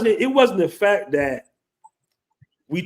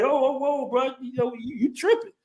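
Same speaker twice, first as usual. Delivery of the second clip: higher, softer, alarmed friend holding him back; imitating a friend; 9 semitones higher, 5 dB quieter than usual. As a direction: pull your buddy back in a panic. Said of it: "My boy's like".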